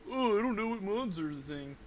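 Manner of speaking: high-pitched mock-confused voice; mock clueless game. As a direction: play the game acting clueless. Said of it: "and then it's like".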